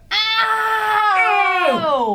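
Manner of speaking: high, loud mock yowl of pain; cry of pain. Game master voices Deathleach hit by a dart, pained yowl. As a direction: yelp loudly in hammed-up agony.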